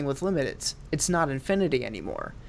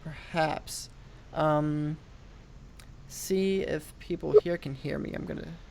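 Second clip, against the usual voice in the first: choppy call audio; clipped phone line; slower than usual. Real, not acted. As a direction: voice dropping in and out. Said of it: "it seems it's a bit choppy".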